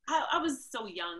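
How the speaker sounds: normal talking voice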